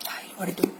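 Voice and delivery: whispered hint to the pupil; a teacher's quiet whispered cue; whispered prompt. Real, not acted. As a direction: whisper the answer so only she hears. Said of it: "whispered prompt to pupil".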